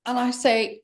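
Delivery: ordinary talk, nothing marked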